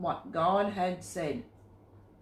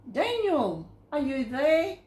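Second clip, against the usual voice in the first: high, louder anxious call down into the den; 7 semitones higher, 5 dB louder than usual. A narrator's reading. King calls down into the lions' den, worried and loud. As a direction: call down into the pit, hoping. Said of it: "and called".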